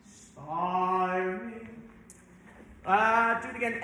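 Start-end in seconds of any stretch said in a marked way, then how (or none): 0.0-1.7 drawn-out demo. Shown as a demonstration. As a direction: stretch "Aspiring" very slowly.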